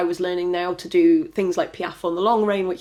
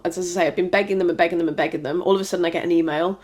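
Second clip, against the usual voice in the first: ordinary delivery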